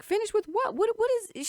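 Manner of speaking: high, loud bewilderment; confused. Retold or imitated. Woman answering the rude stranger, confused. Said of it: "she's so confused".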